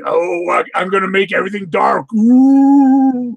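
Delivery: hammy cartoon villain voice, rising to a loud ooh; mustache twirling villain. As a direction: play a sneering cartoon baddie. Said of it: "he's just a mustache twirling bad guy".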